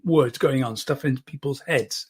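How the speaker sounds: ordinary talk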